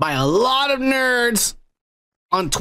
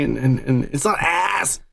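normal talking voice

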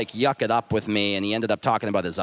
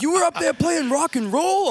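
mock excitement; 3 dB louder than usual. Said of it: "He's like".

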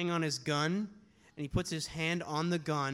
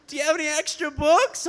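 eager fan; 9 semitones higher, 7 dB louder than usual. Retold or imitated.

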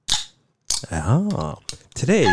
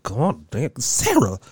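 mock scolding; 7 semitones higher, 3 dB louder, much slower than usual. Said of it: "I was like".

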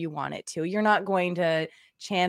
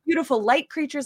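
higher, louder, gushing spiritual rapture; mock ecstatic; 6 semitones higher, 8 dB louder than usual. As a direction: gush like a blissed-out light being. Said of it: "but it was just like".

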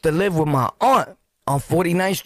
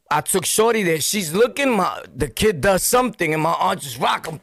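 ordinary talk

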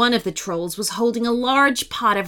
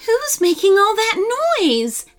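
concerned mother bat; 10 semitones higher than usual. A narrator's reading. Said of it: "asked a concerned Betty".

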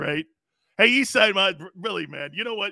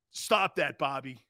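usual speaking voice

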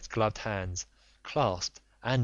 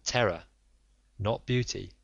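ordinary delivery

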